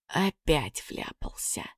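quiet, slow and glum. A narrator's reading. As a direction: mutter it dejectedly. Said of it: "приуныл парнишка".

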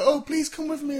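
high whiny plea; mock pleading. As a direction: beg in a needy voice. Mimicking pleading friend.

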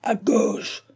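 high, strained cry of command; fierce, high-pitched command; terrible voice. A narrator's reading. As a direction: roar an order in an emergency. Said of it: "d'une voix terrible".

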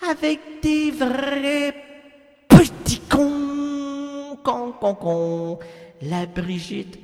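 slow, drawn-out ballad narration over music; dramatic chanson recital. Acted. Mock tragic ballad about lonely Brigitte, slow and sung-spoken. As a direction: croon it like a sad French chanson.

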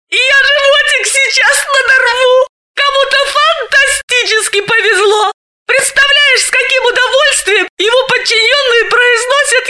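high laughing shouts. Acted. Galina mocks the boss's surname with friend, laughing shouts. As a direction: howl it through the laughter.

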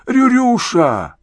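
high, slow pitiful call; plaintive call. A narrator's reading. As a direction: call after him pitifully. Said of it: "жалостливо позвал Иван Иванович".

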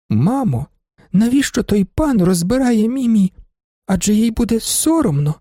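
put-on high, childlike questioning; small girl murmuring. A narrator's reading. Little girl asks her mother, high and childlike. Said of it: "тихо промовила".

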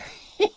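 high, shrill rodent cackle; squeaky evil giggle. Acted. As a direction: giggle like a gleeful rat king. Mouse king's laugh.

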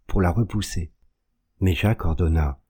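usual speaking voice

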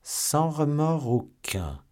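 slow, high-pitched haughty retort; lofty, drawn-out disdain. A narrator's reading. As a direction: draw it out loftily and high. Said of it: "Buckingham regarda le jeune homme avec hauteur".

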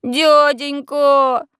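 long drawn-out begging cry; pitiful pleading. A narrator's reading. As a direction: beg like a street urchin. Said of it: "самое жалкое из возможных обращений".

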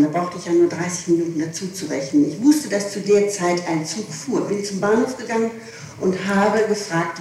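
ordinary talk

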